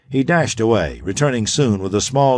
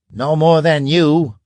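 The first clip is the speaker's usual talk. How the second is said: a touch louder, slow and firm; mild roar; 7 semitones higher, 5 dB louder than usual. Acted. Grag snapping back at Otho, slow mild roar. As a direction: Grag hits back at Otho, mildly roaring. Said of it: "roared Grag".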